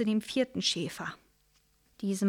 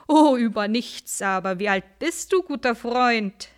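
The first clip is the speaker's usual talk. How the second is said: mocking; 2 semitones higher, 5 dB louder than usual. Shepherd mocks wolf.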